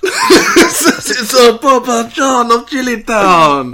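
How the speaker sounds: put-on high, slow French accent, laughing; hammy French voice. Joining the French bit.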